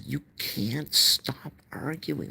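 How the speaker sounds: exasperated retelling, high and drawn out; retold exasperation. Speaker retells scolding mother for arguing, exasperated.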